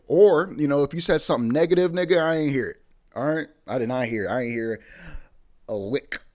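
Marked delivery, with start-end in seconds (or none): 0.1-4.8 mock loud dismissal. Mock dismissing listeners' complaints.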